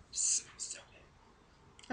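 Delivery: whispered. Real, not acted. Sniffing dip, savoring.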